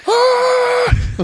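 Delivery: very high, loud mock shriek; imitated shocked scream. Viewer reacting to the leg snap, mock shriek. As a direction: let out a high comic scream.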